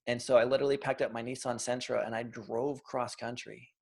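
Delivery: normal talking voice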